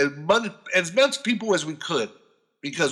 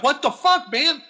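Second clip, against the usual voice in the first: mock outrage; 9 semitones higher than usual. Retold outrage at lawyers.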